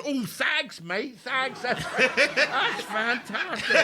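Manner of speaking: high, laughing mock thanks; laughing skinhead. Skinhead thanking comic after headbutt, laughing high. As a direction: play the dazed fan giddy with laughter.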